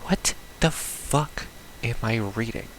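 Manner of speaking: normal talking voice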